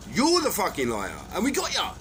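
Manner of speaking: loud, high furious ranting; angry rant. Real, not acted. Ranting at the people he accuses, loud and furious. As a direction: shout the threats at them, fuming.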